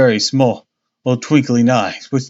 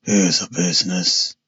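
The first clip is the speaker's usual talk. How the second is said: lower, muffled husky policeman's cry; 5 semitones lower than usual. Acted. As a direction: let it come out muffled and husky. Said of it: "he cried in a muffled, husky tone".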